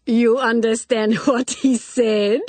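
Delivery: high, loud laugh breaking into the question; amused senora laughing mid-line; Spanish lady laughing at a wicked joke. A narrator's reading. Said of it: "laughed loudly and melodiously".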